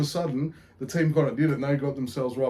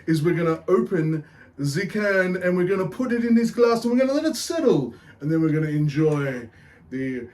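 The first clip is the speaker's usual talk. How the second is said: put-on Irish accent; 3 semitones higher than usual. Beer show host greets punters, hammed-up Irish accent. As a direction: put on a cheeky Irish brogue. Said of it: "keep this accent up".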